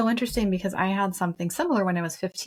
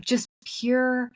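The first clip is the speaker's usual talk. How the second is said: normal talking voice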